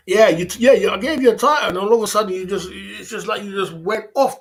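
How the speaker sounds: loud mock reproach to a viewer; loud teasing. Real, not acted. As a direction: call him out, loud and playful. Teasing viewer who vanished.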